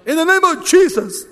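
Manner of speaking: high, loud mock invocation; theatrical exorcist. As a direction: belt it out like a hammed-up exorcist. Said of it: "theatrical people, try to cast out demons".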